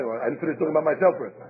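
usual speaking voice

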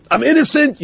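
hoog en opgetogen, a congregant glad to be let off; relieved delight. Acted. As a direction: sing it out with relief at being off the hook.

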